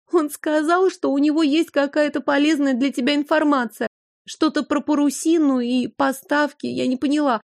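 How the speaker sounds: put-on high, lost young girl's babble; dazed babbling. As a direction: babble half-delirious, frightened. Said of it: "лепетала сестра, как в полубреду".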